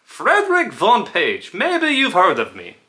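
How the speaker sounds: high, loud put-on foreign accent; Frederick Von Page. Shown as a demonstration. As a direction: introduce yourself in a hammed-up, high-pitched accent. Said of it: "a video on voices".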